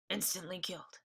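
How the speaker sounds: soft, dazed echo; stunned repeat. A narrator's reading. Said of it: "repeated Tom, staring".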